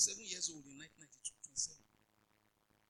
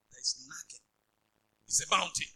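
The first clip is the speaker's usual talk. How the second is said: ordinary delivery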